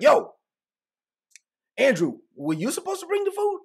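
squabbling disciple demands who forgot the food; arguing disciple. Retold or imitated. Disciple blaming Andrew over the bread, high pitched accusing. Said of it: "so they're having an argument like".

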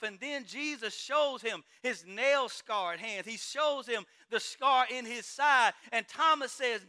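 loud, fervent preacher voicing the apostles; preaching at full force. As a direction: preach it, lifting the crowd with each testimony. Voices apostles in passionate preaching.

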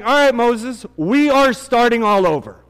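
loud, high, exasperated God; God's voice. Retold or imitated. God tells Moses he will start over, loud and exasperated. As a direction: play an angry God ready to start over. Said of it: "God comes to Moses and he's like".

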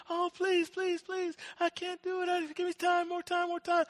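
high, thin begging; acted desperate plea; pleading. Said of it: "And the servant pleaded and pleaded and pleaded".

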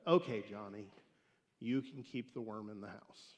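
low, soft resigned mother; giving in. Acted. Mother caving to her whining son, soft and resigned. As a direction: sigh out the surrender.

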